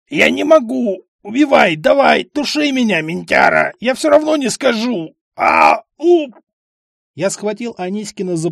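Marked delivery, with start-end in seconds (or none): 0.1-6.4 high, loud, defiant screaming; defiant desperate yelling. Acted. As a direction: scream back at the cop, refusing to talk. Бандит кричит под давлением. (отчаянный высокий крик)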